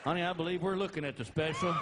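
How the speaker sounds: loud retort. Retold or imitated. Dad's loud retort in story.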